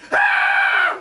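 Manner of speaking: very high loud shriek. Retold or imitated. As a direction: shriek at the wound and faint. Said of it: "and she goes".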